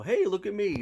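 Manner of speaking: high, exaggerated showing off; mock attention-seeking. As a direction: call out like a pretend show-off. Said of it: "It's not like I go to them and go".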